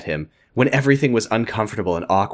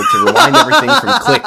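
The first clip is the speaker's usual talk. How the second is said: reading through laughter, louder as it breaks; cracking up while reading; 7 dB louder than usual. Real, not acted. Podcaster reading fan fiction aloud, cracking up. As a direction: laugh your way through the line.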